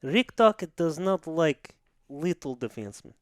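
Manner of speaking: mock Russian, articles dropped; hammed-up Russian coach making his case; bad Russian accent. Coach explaining his love of small defensemen, mock Russian accent. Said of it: "the worst Russian accent".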